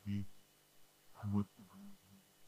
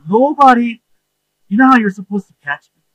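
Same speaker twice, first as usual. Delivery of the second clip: excited; 8 semitones higher, 9 dB louder, faster than usual. Real, not acted. Excited storytelling, rising energy.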